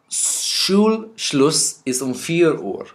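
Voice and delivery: slow, careful teacher model; slow model phrase. Shown as a demonstration. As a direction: spell it out slowly for learners. Teacher models German sentence slowly.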